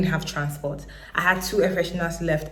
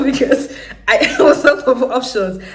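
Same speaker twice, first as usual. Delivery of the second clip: louder, laughing through the words; 6 semitones higher, 7 dB louder than usual. Real, not acted. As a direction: laugh at how hopeless it was. Laughs at her own desperation.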